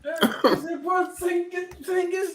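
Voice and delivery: high, slow, laughing mock disbelief; laughing mockery. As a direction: cackle through the insult. Mocking player in the clip.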